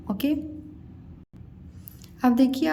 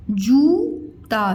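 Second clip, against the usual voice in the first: जूता stretched slowly, syllable by syllable; drawn-out syllables; much slower than usual. Shown as a demonstration. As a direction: draw out जूता for dictation. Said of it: "मतलब जूता". (जूता को धीरे-धीरे खींचकर बोलें)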